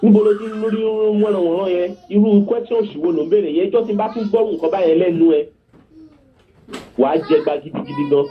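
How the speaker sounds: acted maternal rebuke, rushed and high; mother's scolding. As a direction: imitate a mother rattling off threats. Retold mother scolding her daughter.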